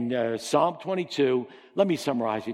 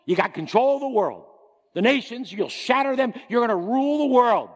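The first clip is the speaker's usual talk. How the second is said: higher, louder commanding proclamation; God's decree; 9 semitones higher, 6 dB louder than usual. Acted. God promising his son the world, loud commanding. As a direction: declare it like a king crowning his son. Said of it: "God told me".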